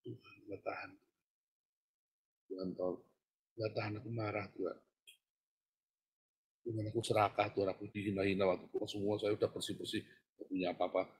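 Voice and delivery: soft, broken confession to God; hushed anguished prayer. Retold or imitated. Preacher reenacting his prayer to God, hushed and despairing. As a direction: pour it out quietly, barely holding on.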